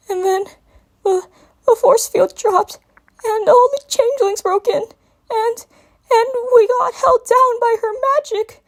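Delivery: high, stammering, on the edge of tears; near crying. A narrator's reading. Young dragon recounts the nightmare to Twilight, almost crying. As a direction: voice close to breaking. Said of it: "voice struggled not to break".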